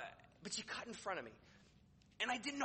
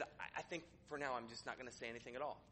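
quiet backing down; 7 semitones lower, 8 dB quieter than usual. Acted. Imagined employee backing down.

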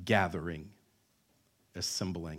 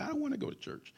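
high, soft whine of refusal; reluctant son; 13 semitones higher, 10 dB quieter, faster than usual. Acted. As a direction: balk like a kid who won't get up.

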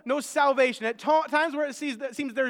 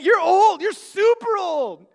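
louder, higher retold insult, slow on super; mock insulted; 7 semitones higher, 9 dB louder, slower than usual. Preacher echoes the girl's insult, higher and louder. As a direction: throw her words back in mock outrage.

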